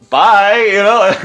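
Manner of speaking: high-pitched, tentative farewell; awkward high goodbye. Retold or imitated. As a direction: send it up high, acting out a nervous exit. Awkward exit from the gang.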